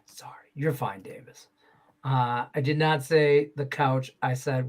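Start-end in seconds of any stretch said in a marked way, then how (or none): 0.0-1.5 quick whispered aside; whispering to Davis so the show goes on. Real, not acted. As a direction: whisper an apology off-mic. Aside to a co-host mid-show.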